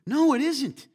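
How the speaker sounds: loud, high, sharp correction; emphatic denial. Real, not acted. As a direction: shut the idea down hard. Preacher rebuts imagined agreement.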